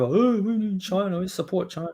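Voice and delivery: mock the star's fawning, pitch up; mocking LeBron. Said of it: "Le Cuck James running over".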